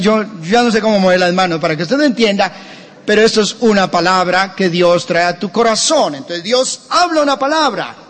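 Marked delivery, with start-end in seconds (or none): none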